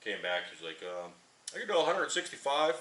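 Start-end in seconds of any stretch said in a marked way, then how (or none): 1.5-2.8 casual quote. Retold or imitated. Tire guy quoting a price.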